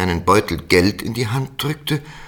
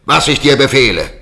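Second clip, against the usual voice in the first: high, loud commanding shout; 11 semitones higher, 6 dB louder than usual. Acted. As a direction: bark orders at the frightened boy. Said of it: "und schrie".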